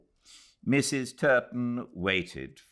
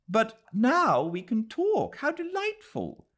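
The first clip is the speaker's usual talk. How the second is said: bright, higher burst of delight; light up with joy as you say it; thrilled to finally talk at last; delighted cry; 5 dB louder than usual. Acted. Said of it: "cried Adela".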